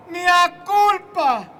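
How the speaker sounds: slow loud wailing mea culpa; repeated anguished mea culpa. Retold or imitated. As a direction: drag out the second mea culpa.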